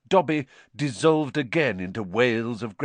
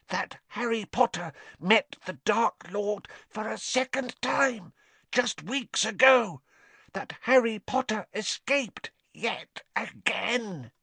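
hoarse-meant elf voice; 13 semitones higher than usual. A narrator's reading. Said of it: "he said hoarsely".

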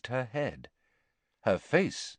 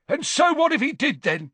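defiant; 4 dB louder than usual. A narrator's reading. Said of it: "Gaunt said defiantly".

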